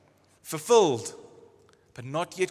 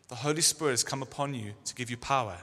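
ordinary delivery

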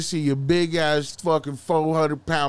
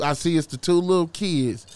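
ordinary talk, nothing marked

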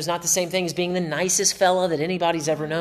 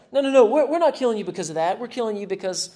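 a hint of a sneering accuser, pitched higher; mocking persecutor; 6 semitones higher, faster than usual. Preacher voices persecutors jeering at Jesus, mildly higher. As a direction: jeer out the excuse.

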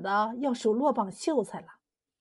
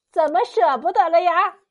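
higher, louder jeering question from above; mocking taunt; 5 semitones higher, 5 dB louder than usual. A narrator's reading. Queen Mother jeering at the fairy from the clouds, mocking taunt. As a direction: call it out as a taunt.

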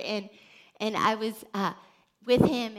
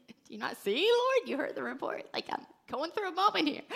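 exasperated protest; 7 semitones higher than usual. Retold or imitated. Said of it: "I'm like".

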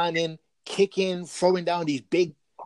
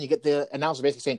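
ordinary talk, nothing marked

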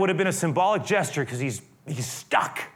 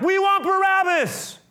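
very high, drawn-out crowd cry; mob yell; 4 dB louder, slower than usual. Retold or imitated. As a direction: imitate a mob yelling back. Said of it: "They yell back".

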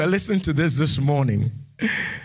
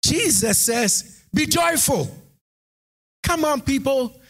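full-force preaching; 9 semitones higher, slower than usual. Acted. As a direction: preach it out, lifting the congregation.

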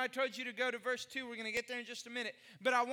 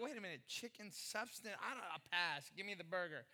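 lower, much softer, wary refusal; skeptical diner; 7 semitones lower, 9 dB quieter than usual. Retold or imitated. Diner to mayor-waiter, refusing the chicken, muttered lower. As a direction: pass on it under the breath.

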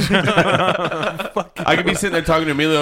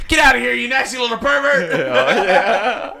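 mock angry shout; 8 semitones higher, much slower than usual. Quoting angry movie line.

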